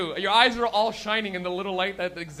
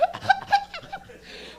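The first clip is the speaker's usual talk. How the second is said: very high, squeezed cough; stifled cough. Retold or imitated. Comic mimics guy at the back, squeaky stifled cough. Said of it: "trying not to cough".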